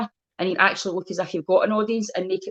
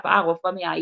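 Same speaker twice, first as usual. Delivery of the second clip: normal talking voice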